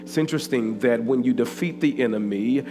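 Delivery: loud, slow sermon reading over music; full-force preaching. Acted. Pastor preaching scripture loudly over music. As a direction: proclaim the scripture to the church.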